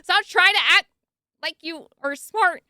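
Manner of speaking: high, loud scold at the writer; mock scolding. Real, not acted. Scolding the author for using big words, loud and high. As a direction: tell the author off, half laughing.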